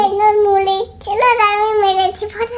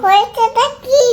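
হয়েছেটা কি at normal pace, a touch louder; sharper question; 4 dB louder than usual. Pretend. Child asking crying father again, more firmly. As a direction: snap হয়েছেটা কি out briskly. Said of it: "child repeats question differently".

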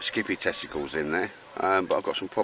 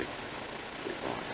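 slow whispered "A bit bright", stretched out under the breath; whispered aside; 8 semitones lower, 13 dB quieter, much slower than usual. Real, not acted. Muttered repeat of remark.

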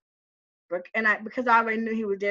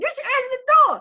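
high, loud indignant scolding; mad reproach; 13 semitones higher, 6 dB louder, much faster than usual. Retold or imitated. Scolding the man who ignored her knocking, high and loud. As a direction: tell him off, hurt and mad.

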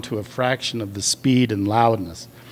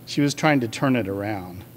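ordinary delivery

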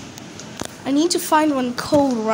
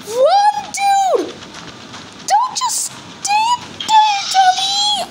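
frantic yelling; much slower than usual. Real, not acted. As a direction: yell at him to run. Yelling at another player.

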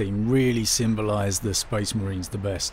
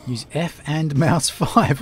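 laughing; 4 semitones higher, slower than usual. Real, not acted. Puzzled by the controls, laughing.

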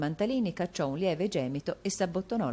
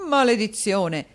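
loud, higher dramatic outburst; exclaimed curse; 6 semitones higher, 8 dB louder, slower than usual. A narrator's reading. Husband hearing his allowance, cursing loudly. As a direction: curse the sum aloud. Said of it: "esclamò il signor Mantalini".

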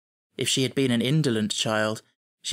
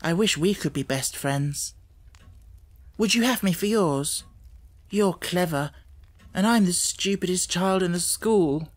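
put-on higher girl's voice, timid and eager; a plain girl pleading to be liked; lightly voiced bashful schoolgirl; shy Ermengarde; 6 semitones higher than usual. A narrator's reading. Ermengarde shyly offers friendship.

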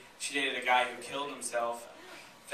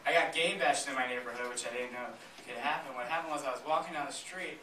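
usual speaking voice

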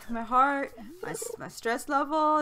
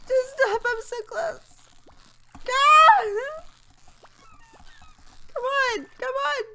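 high-pitched exasperated cries; frustrated yelling; 14 semitones higher, 9 dB louder than usual. Real, not acted. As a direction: yell at the fish in desperation. Struggling to land fish.

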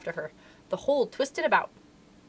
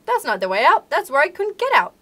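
loud, high warning shout; crying out; 6 semitones higher, 9 dB louder than usual. A narrator's reading. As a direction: protest loudly, pitch climbing. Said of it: "he cried".

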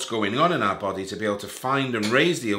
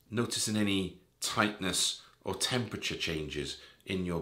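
softer, lower meditation voice with long pauses; guided meditation; 3 semitones lower, 4 dB quieter than usual. Real, not acted. Guide leading listeners through a body scan, calm guided meditation. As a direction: ease the listener gently through each part of the body, settling softer as it goes.

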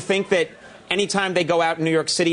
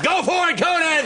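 high shouted cheer from the crowd; mock crowd yell, pitched up; audience egging him on; 11 semitones higher than usual. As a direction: holler it like a fan urging him on. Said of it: "Guys in the audience are like".

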